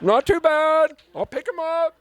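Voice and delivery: high, strained "Not too bad"; trying to sound casual while squeaking high; high squeaky. Shown as a demonstration. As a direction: squeak it out high like a voice about to crack. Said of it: "I said it like that".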